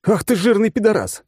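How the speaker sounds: high, loud, furious insult; snapping at last in rage; angry outburst. Acted. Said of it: "не выдержал я".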